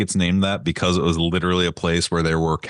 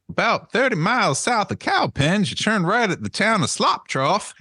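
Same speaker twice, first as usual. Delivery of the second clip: silly high voice; 12 semitones higher than usual. Pretend. Joke directions in silly voice.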